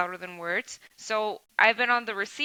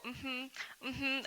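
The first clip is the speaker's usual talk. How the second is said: soft, higher mock nodding hums; bobblehead mm-hmm; 4 semitones higher, 11 dB quieter than usual. Shown as a demonstration. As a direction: hum along like a nodding bobblehead. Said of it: "I don't wanna be a freaking bobblehead".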